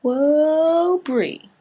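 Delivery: high, loud drawn-out whoa; impressed whoa. Retold or imitated. Vlogger reacting to Bree bringing out a gun, loud awed whoa. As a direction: stretch the whoa in awe. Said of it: "I was like".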